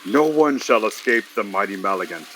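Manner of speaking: ordinary talk, nothing marked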